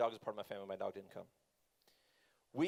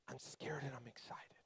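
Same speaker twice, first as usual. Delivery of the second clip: slow, barely audible mock-frightened whisper; scared whisper; 4 semitones lower, 16 dB quieter than usual. Dad imitating scared daughter on the ride, whispering. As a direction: whisper it like a petrified kid, slowly.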